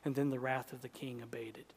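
a breathy, hushed whisper as the rage dies. Acted. Preacher tells the congregation the king's anger is over, in a whisper. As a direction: let the words fall to a hush.

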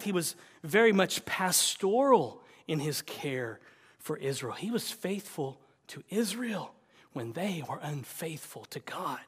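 normal talking voice

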